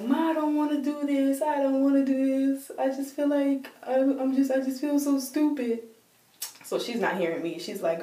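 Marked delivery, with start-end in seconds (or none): 0.0-5.8 mock crying. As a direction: act out a tearful, pleading wail. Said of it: "so I'm crying like".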